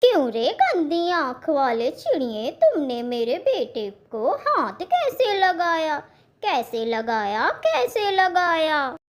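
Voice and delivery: indignant, repeated challenge; angry mother. Acted. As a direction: confront him as a protective mother. Mother confronts the vendor.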